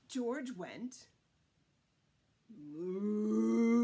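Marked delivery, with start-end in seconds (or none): 2.5-3.8 a put-on mooing cow. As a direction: moo like a cow. George makes a wrong animal sound.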